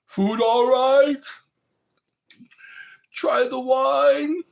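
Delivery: high, loud and slow, a hammed-up host; loud slow mock film host offering food; Clockwork Orange quote. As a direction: press the wine on him with menace, slowly. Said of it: "A Clockwork Orange".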